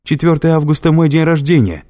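high loud desperate cry; desperate scream. A narrator's reading. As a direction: scream it out, pleading. Триш кричит в отчаянии. (отчаянный крик)

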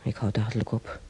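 ordinary delivery

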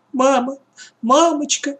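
put-on high, frightened little girl's wail; plaintive squeak. A narrator's reading. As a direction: squeak for mummy in fear. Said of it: "жалобно запищала Таня".

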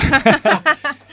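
a loud, high burst of real laughter. Real, not acted. Laughing out loud when the host asks who the rappers are. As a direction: crack up at the question.

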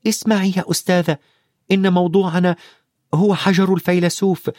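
put-on high-pitched boy's voice, insistent; Harry, throwing caution away. A narrator's reading. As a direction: make the teacher listen, boyish and high. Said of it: "ألقى هاري بالحذر خلف ظهره".

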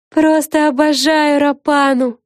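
high, loud, slowly savoured delight; high-pitched, adoring storybook voice; gushing exclamation. A narrator's reading. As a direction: gush about it, stretching the words. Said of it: "воскликнула Флафанора".

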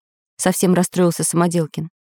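ordinary delivery, nothing marked

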